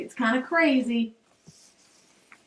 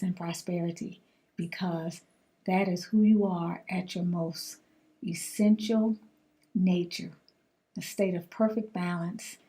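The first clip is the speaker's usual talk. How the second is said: affirmation; 3 semitones lower, 4 dB quieter than usual. Acted. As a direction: ease them into calm, hushed and unhurried. Closing affirmation to viewers.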